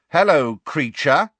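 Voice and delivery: loud, high mocking greeting; very loudly. A narrator's reading. Fred greeting the muttering elf, very loud. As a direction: announce it loudly to make him jump. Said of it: "said Fred very loudly".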